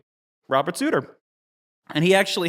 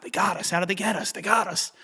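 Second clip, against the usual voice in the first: dismayed; 6 semitones higher than usual. Retold or imitated. Thinks they lost the race.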